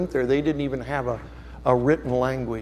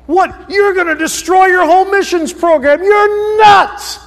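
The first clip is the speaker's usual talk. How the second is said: starts indignant, then rises higher and louder into a yell; building to a shout; 10 semitones higher, 7 dB louder than usual. Retold or imitated. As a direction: work yourself up from disbelief to shouting at the pastor. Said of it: "I'm thinking all this".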